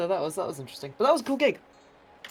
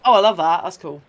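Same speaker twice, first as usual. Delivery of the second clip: delighted; 3 semitones higher, 13 dB louder than usual. Retold or imitated. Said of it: "It's like".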